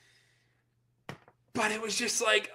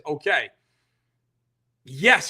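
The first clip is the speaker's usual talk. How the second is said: ordinary delivery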